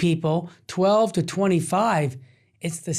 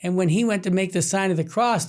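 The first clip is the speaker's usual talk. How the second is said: normal talking voice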